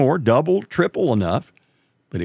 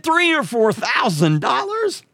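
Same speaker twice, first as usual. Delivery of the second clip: high, slow, incredulous squeak; shocked disbelief; 13 semitones higher, slower than usual. Retold or imitated. To the repairman, aghast at the price, incredulous high squeak. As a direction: repeat the price back like you can't believe it.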